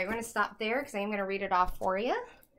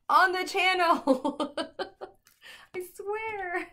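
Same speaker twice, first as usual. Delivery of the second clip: loud, high, drawn-out laughing shout; high, stretched-out, amused yell; laughing exclamation; 9 semitones higher, 7 dB louder, much slower than usual. Real, not acted. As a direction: burst out laughing as you call it. Laughing correction of her own brag.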